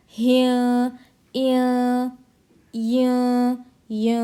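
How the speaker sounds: "इय, बिय" as i gliding into a long nasal hum; drawn-out nasal ikhfa. Shown as a demonstration. Teacher drilling ikhfa with do zer, long nasal endings. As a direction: stretch "तिय" for one alif, n hidden in the nose. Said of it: "खींच कर पढ़ना है".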